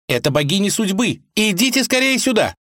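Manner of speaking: put-on high, eager girl's voice; young woman voice. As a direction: call them over brightly. Vasilisa voiced in story.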